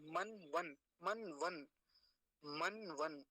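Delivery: "मन वन" as two closed syllables ending on न्; half न demo. Shown as a demonstration. Teacher showing timing of half न, closed syllables. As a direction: close each of "मन वन" on न, no vowel after. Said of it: "आधा ही समय लेंगे बोलने में".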